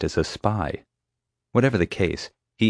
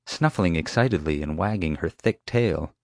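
plain reading voice

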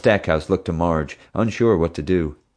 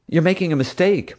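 mother's voice; 11 semitones higher than usual. Acted. Mother character voice.

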